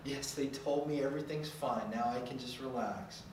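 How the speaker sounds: hushed, sarcastic self-soothing parent; mock relaxed. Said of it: "You don't go".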